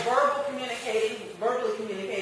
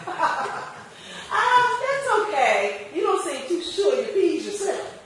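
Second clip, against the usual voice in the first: laughing polite refusal, a little higher; amused brush-off; 7 semitones higher than usual. Retold or imitated. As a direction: let them down lightly with a chuckle. Customer turns the seller down.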